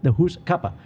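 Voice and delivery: Scottish hoose, clipped; Scottish accent. Shown as a demonstration. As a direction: say hoose like a Scot. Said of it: "Cuppa what?".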